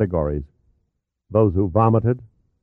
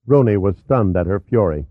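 ordinary delivery, nothing marked

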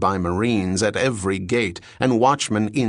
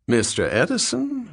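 Southern landlady drawling the name up high; 12 semitones higher than usual. A narrator's reading. Landlady greeting Bell about her lodger, Southern drawl. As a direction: stretch Mister Edison out in a lazy Southern drawl. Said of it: "she drawled".

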